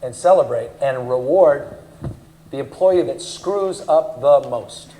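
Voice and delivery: slowed right down, each verb set apart; deliberate slow emphasis. Real, not acted. Speaker to audience, unpacking the award, slow and deliberate. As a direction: spell it out word by word for effect.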